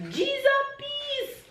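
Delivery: very high, slow G's and peace; excited Jamaican exclamation. Shown as a demonstration. Mother correcting herself to the Jamaican phrase, very high excitement. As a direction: sing it out in Jamaican delight.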